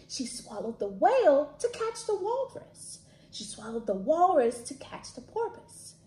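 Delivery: lively storybook read-aloud; animated picture book reading; reading to children. Acted.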